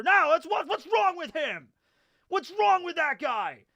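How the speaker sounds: high, strained mock shout; indignant hollering, played up; imitated angry yelling. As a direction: retell a ranting man who won't calm down. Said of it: "he was still yelling".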